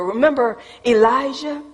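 ordinary delivery

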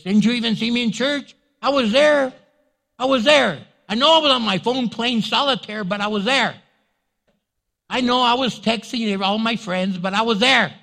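high, loud self-justifying whine; indignant protest. Acted. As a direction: insist defensively, hammed up. Acted churchgoer protesting to God.

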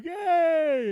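high, drawn-out mock greeting; imagined listener. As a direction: call out as the delighted listener. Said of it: "He's listening too, going".

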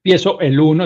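ordinary delivery

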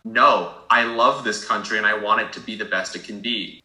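ordinary talk, nothing marked

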